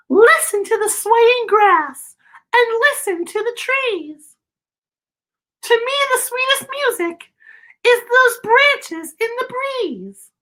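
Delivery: a tiny, polite cricket clearing his throat. A narrator's reading. Cricket sings advice to Gerald.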